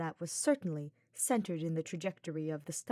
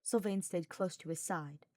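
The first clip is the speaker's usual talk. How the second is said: ordinary delivery, nothing marked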